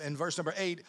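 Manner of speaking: ordinary talk, nothing marked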